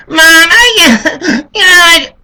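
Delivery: put-on high, loud laughing-crying child; whimpering kid. Kid whining, half laughing.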